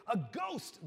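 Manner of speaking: high loud cry of "A ghost"; mock terrified cry; cried out in terror. A narrator's reading. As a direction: call it like a frightened sailor. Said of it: "they cried, crying out in terror".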